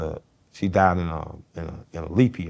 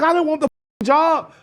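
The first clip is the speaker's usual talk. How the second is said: defensive; 6 dB louder than usual. Retold or imitated. Repeat, higher, far.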